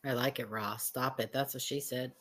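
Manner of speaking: ordinary talk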